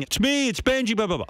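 imitating a frantic scream; retold screaming. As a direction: shriek it like a frantic man. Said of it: "Benji starts screaming".

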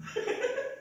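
short, high breathy laugh; real laugh. Real, not acted. Speaker laughs after saying the Lenin book line, real short giggle. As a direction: break into a brief giggle. Laughs between two takes.

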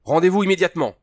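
high, loud order to surrender; fearful demand. A narrator's reading. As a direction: demand surrender with a note of alarm. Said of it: "une note apeurée dans le ton de l'homme".

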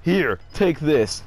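drawn-out, slow video game NPC. Retold or imitated. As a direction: hand it over like a game character.

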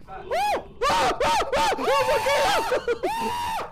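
panicked shriek of laughter; scared laugh-scream. Real, not acted. Horror game jump scare.

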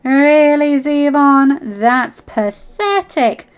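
high, loud, drawn-out mockery; taunting, shocked. Shayla mocking Zevon's injury, taunting with shock. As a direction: jeer in disbelief. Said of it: "Shayla taunted, though genuine shock".

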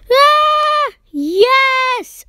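high, drawn-out cry of victory; triumphant cheer. Real, not acted. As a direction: let out a long, high cheer. Celebrates the game result.

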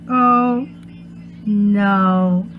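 ordinary delivery

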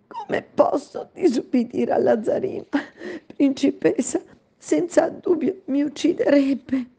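high, tearful servant's voice; frightened maid. Acted. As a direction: plead helplessly, near tears. Maid answers princess.

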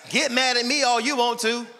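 high, loud preacher's shout; full-voiced pulpit shouting; preaching at full force. Real, not acted. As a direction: preach it out hard to the congregation. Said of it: "I'm preaching".

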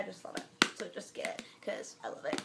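soft unvoiced whisper. Real, not acted. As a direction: whisper it like a secret. Confiding product push.

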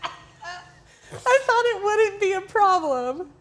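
crying with laughter, high and drawn out; squealing, breaking up as you tease; helpless laughter. Real, not acted. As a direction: barely get the words out for laughing. Cracking up over printing mistake.

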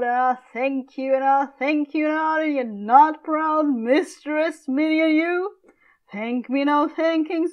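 mock her words back at her; mocking Capulet. Father mocking his daughter's chopped logic, scornful.

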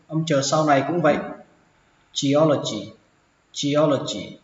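normal talking voice

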